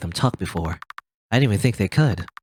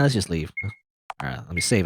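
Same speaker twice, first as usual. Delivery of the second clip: normal talking voice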